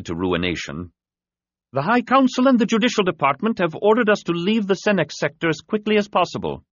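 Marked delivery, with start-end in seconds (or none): 1.7-6.6 high, pressing voice with orders; urgent commander voice. A narrator's reading.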